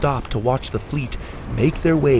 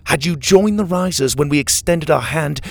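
loud, raised, triumphant rebuke; imperious proclamation; 7 semitones higher, 7 dB louder than usual. A narrator's reading. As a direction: build to a big ringing finish. Villain rebukes prisoner, proclaiming victory.

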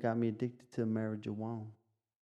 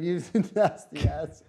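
laughing; 5 semitones higher, 10 dB louder than usual. Real, not acted. Teasing friend after rectum joke.

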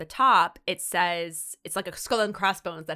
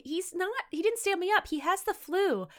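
put-on defensive girlfriend; 11 semitones higher than usual. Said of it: "She's like".

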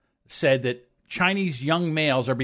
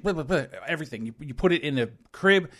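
usual speaking voice

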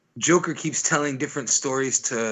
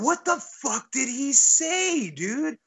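high, slow disbelief; incredulous; 12 semitones higher, slower than usual. Real, not acted. Friend telling a Batman story, incredulous at the cut. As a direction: drag out the disbelief, pitch way up. Incredulous reaction to Harley scene.

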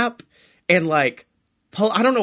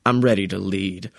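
deep villain line; 12 semitones lower than usual. Retold or imitated. Oompa taking over the gang after the shooting, deep villain voice. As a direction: declare it like a newborn supervillain. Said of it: "he goes".

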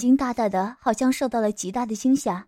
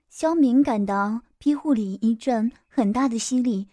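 plain reading voice

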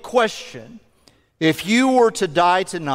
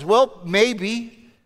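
ordinary delivery

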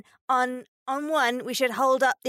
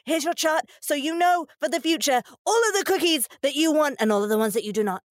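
put-on higher, louder, rushed kid voice; eager kid voice; 7 semitones higher, 4 dB louder, faster than usual. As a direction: gush like an excited child showing off. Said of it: "And being like".

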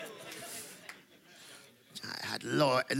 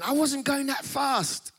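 higher, slow whiny protest; pleading protest; 9 semitones higher, slower than usual. Retold or imitated. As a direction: plead your innocence to the cop. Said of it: "I'm like going".

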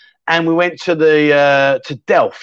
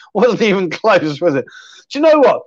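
usual speaking voice

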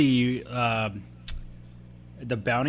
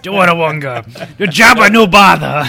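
Huttese imitation; 3 semitones higher, 14 dB louder than usual. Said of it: "without hearing Dawanawanga".

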